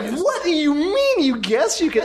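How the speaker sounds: incredulous inner voice. Retold or imitated. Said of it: "Jay internally, he's like".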